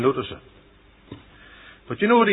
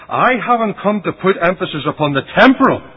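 raised-pitch preaching denial; emphatic rejection; 7 semitones higher, 4 dB louder than usual. Retold or imitated. Christ refusing the temporal before the people, emphatic. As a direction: lift it high as a firm refusal.

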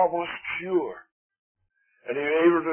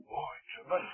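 hushed, breathless mock awe; awed whisper; 13 dB quieter than usual. Preacher mocking a starstruck sports fan, whispered.

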